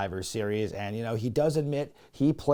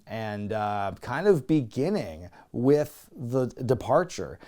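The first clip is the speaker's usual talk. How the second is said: ordinary talk, nothing marked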